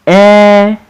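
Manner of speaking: "Elephant" in three held pieces with pauses, high at the start; "Elephant" pulled apart for learners to blend; slow syllables. Shown as a demonstration. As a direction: read "Elephant" slowly, one syllable at a time. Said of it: "I will read the words slowly".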